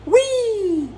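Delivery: high, happy squeal; gleeful whee. Acted. [name] throws heads to the crowd with a high squeal. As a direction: toss them with childish glee.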